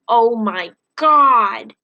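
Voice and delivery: slow, loud, stretched-out cry; mock shriek. Freak shrieking in disbelief at his friend, slowly. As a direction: drag it out in disbelief. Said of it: "he is shrieking and laughing and whooping".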